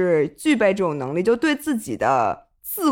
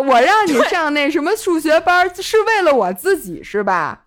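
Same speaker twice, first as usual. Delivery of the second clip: higher, louder nagging parent voice; mock nagging parent; 5 semitones higher, 4 dB louder than usual. Podcast host mimics a parent scolding a child, higher and louder. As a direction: play the guilt-tripping mom. Mocking parents' guilt trip.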